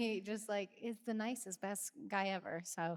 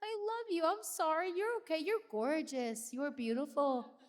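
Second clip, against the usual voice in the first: high, soothing reassurance; comforting family; 7 semitones higher than usual. Retold or imitated. Family members comforting her while she cries, high and tender. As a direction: coo comfort at someone crying. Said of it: "like a, family".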